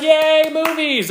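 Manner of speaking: high, slow, gleeful mock cheer; excited cheer. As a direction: cheer like a kid at the movies. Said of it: "and then you're just like".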